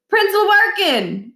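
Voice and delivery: calling out loud and high; high yelled name; shout. A narrator's reading. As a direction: shout across the quarry. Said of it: "Miles shouted".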